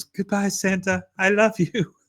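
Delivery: high, sweet imitation of a child; little girl. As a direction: play a small girl saying farewell. Little girl looks at him and goes.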